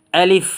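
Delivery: teacher models আলিফ as a crisp rising syllable; letter alif. Shown as a demonstration. Teacher to students, a clear rising আলিফ. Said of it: "এটার নাম হচ্ছে".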